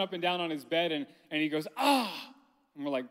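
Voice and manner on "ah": high-pitched mock "ah" of pain; dramatic groan. Dad imitating his son clutching his stomach, dramatic groan. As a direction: imitate a kid's theatrical yelp. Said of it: "That's kinda dramatic".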